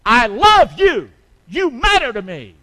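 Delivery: I love you stretched slow and loud. Shown as a demonstration. Preacher showing fathers how loudly to say I love you. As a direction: preach it slow with full force. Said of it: "Start talking like that".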